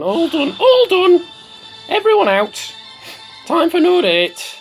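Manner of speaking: high, loud singled-out calls; calling out. Pretend. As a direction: shout it like clearing everyone out. Calls time on the game node.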